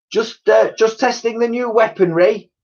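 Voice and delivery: put-on high, excited child voice; girl exclaiming. A narrator's reading. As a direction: blurt out a cover story as the girl. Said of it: "exclaimed Elsie".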